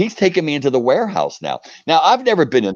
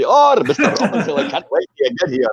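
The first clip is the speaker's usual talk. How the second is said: put-on higher, laughing Scottish accent of the letter writer; 5 semitones higher than usual. Ian McDonald's letter to the American fan, in a Scottish accent. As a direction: read the letter in a cheery Scottish lilt. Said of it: "you could even hear the accent".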